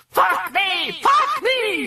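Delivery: very high, frenzied scream. A narrator's reading. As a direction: scream it like one possessed. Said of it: "she screamed at the doctors".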